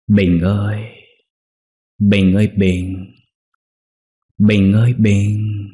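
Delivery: slow, drawn-out ghostly calling; haunting distant call. A narrator's reading. Unseen voice calling Bình at night, haunting call. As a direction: stretch the name out eerily. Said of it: "có tiếng ai gọi vọng vào".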